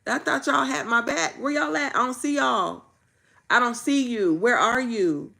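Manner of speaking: frustrated, half-shouted where y'all at; hurt calling out. Retold or imitated. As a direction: call out for the people who said they had your back. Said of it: "And I'm like".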